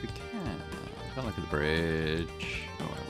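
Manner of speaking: normal talking voice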